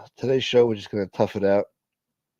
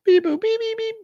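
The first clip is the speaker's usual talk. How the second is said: robot beeps. Pretend. Imitating robot noises.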